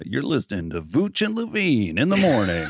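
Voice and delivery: hammed-up morning show DJ intro; drive-time radio host. Joking about a radio show, mock drive-time host. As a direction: play a radio announcer, stretching the words. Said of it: "sounds like a drive time radio show".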